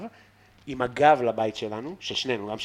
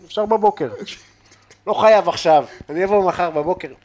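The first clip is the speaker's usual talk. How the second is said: scared reply; 7 semitones higher, 10 dB louder than usual. Retold or imitated. Retelling scared reply to tough guy.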